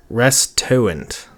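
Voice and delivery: "restaurant" said slowly as "res-TOE-ent"; slow, deliberate "restaurant" with the r and a lost: res-toe-ent; sounding out misspelling. Shown as a demonstration. Sounds out misspelled search terms.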